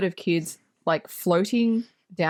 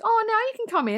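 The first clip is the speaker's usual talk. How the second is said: high, sing-song mock invitation; April now letting them in, high and mocking; mock sweet invite; 12 semitones higher, faster than usual. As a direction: voice April, pitched up and sugary. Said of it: "April goes".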